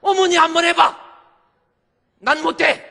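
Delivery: high, very loud shout; screamed refusal. Retold or imitated. Daughter-in-law refusing to nurse father-in-law, screamed refusal. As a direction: act out a furious refusal at full volume. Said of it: "이렇게 소리 지를 일은 아닌데".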